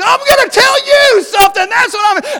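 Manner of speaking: very high, loud acted-out outburst; furious yell. Goaded boy crawling over seats at his cousin, screaming. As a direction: explode in a high-pitched kid's yell.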